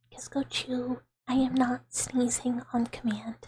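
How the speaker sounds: ordinary talk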